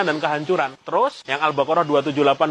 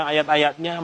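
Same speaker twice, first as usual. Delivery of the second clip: ordinary talk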